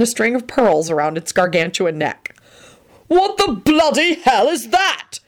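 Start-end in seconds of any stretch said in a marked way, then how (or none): 3.1-5.2 high, slow shocked shriek. Acted. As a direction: shriek it in horror. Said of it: "he shrieked".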